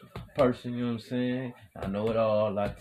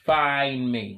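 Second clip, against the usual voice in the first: loud call; 4 semitones higher, 8 dB louder than usual. Real, not acted. Hyped call to listeners.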